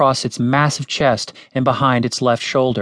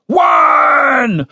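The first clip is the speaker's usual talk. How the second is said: high, loud drawn-out count; shouted countdown; 11 semitones higher, 6 dB louder than usual. A narrator's reading. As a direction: bellow the number from outside. Commander shouts countdown from outside.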